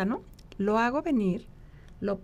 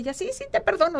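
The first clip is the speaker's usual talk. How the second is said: niña perdonando rápido; 9 semitones higher, 4 dB louder, faster than usual. Retold or imitated. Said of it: "o sea, muy rápido".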